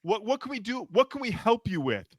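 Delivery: high-pitched mock offer of help; unhelpful. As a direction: offer help but mean go away. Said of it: "with the tone That is not helpful".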